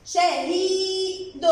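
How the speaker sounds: slow "shahiiid" for شهيد; long vowel. Shown as a demonstration. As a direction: sustain the "ii" in شهيد. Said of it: "مد ياء". (مدّ الياء في شهيد ببطء)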